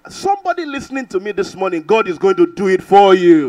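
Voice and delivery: rising to a loud, fervent promise; preaching declaration. Real, not acted. Preacher to the listening congregation, loud fervent declaration. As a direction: proclaim it over the congregation with force.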